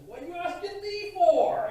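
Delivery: high, loud, exasperated Costello squawk; shrill comedy-routine outburst; Costello worked up. Retold or imitated. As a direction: blurt it like a flustered comic. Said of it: "And gets all worked up".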